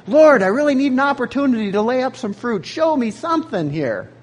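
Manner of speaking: high, loud, fast eager plea; eager Macedonians. Pretend. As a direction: beg the Lord for a chance to give. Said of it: "Like the Macedonians".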